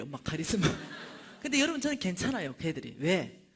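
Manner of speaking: usual speaking voice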